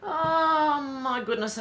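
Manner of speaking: long, high, loud "oh my goodness"; mortified exclamation. Real, not acted. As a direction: wail it out slowly in embarrassment. Mortified after reading a dirty joke.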